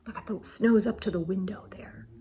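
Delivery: fast unvoiced whisper. Real, not acted. Parent pointing at the deep snow, whispering.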